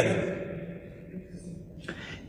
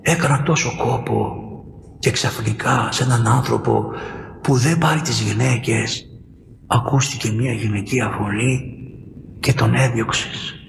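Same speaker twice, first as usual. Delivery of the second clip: mild tearful lament; 8 semitones lower, slower than usual. Retold or imitated. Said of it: "ο γέροντας έκλαιγε και έλεγε".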